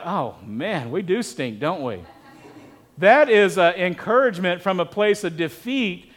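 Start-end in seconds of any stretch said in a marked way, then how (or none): 0.0-2.0 softer, deflated mock groan of a losing team; mock defeated moan. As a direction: sigh it like a beaten team, softer. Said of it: "they'd be like".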